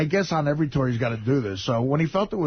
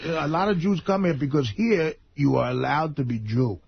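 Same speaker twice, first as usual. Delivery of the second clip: imitated German tour guide; German accent. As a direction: explain earnestly in a German accent. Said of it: "he has that accent".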